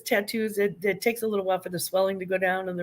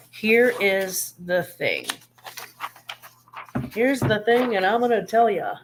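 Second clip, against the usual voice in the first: quoting Letterkenny. Retold or imitated. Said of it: "Anybody who's watched Letterkenny knows that reference".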